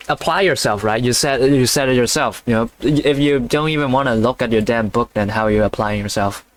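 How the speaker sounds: ordinary delivery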